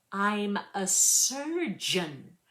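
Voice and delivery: slow, crisp, each sound of "I'm a surgeon" spelled out; mirrored enunciation. Acted. [name] mimicking the chef's enunciation, slow and crisp. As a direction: copy his over-precise diction. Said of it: "mirroring his enunciation".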